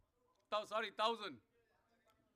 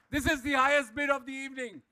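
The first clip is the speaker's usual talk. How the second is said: normal talking voice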